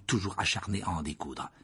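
usual speaking voice

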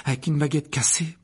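anxious, lifted voice; worried. Acted. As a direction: explain with mild unease. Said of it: "Hermione d'un ton inquiet".

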